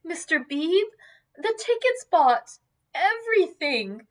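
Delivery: high-pitched, distressed outpouring; near sobbing. A narrator's reading. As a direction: list it in high, tearful distress. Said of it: "She fell sobbing".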